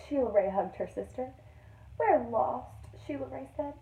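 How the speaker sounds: put-on expressive storytime reading to children; lively picture-book narrator; read-aloud narration. A narrator's reading. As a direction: read it like a teacher to her class. Teacher reads picture book aloud.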